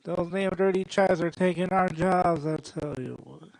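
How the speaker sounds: mock Southern bigot's complaint; Southern accent. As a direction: gripe in a put-on Southern accent. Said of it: "once you attach a Southern accent to it".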